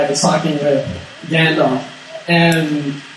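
ordinary talk, nothing marked

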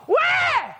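very high mock baby wail; annoying baby cry. Baby insisting till parent comes, high imitated wail. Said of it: "I will do it really hard, really annoying".

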